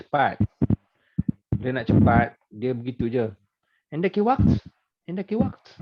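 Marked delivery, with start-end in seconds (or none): 3.9-5.8 quick rising question, pitched higher; fast conversational question tune; question intonation. Shown as a demonstration. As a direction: let waqt lift at the end like a question. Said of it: "Yang penting intonasi".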